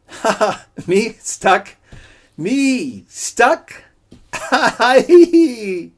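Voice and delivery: very high, loud mock laughing; shrill loud fake cackle; forced laughter. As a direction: laugh it off too hard. Coyote laughs off being stuck.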